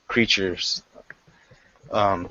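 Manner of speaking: ordinary delivery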